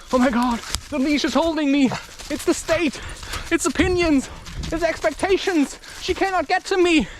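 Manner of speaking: high-pitched mock protest; mock panicked complaint. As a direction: imitate someone helplessly held back. Mimics people blaming what holds them.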